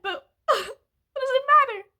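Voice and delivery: tearful question with a broken laugh; crying laugh. Real, not acted. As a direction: laugh through the tears, then slow down. Crying, laugh over credit score.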